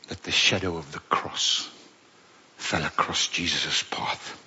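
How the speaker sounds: soft and hushed, fading to a whisper; solemn whisper. Real, not acted. Preacher hushing as the cross enters the story.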